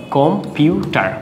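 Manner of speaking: "Computer" slowly in three parts: কম, পিউ, টার; split into syllables. Shown as a demonstration. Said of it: "কমের জন্য একটা".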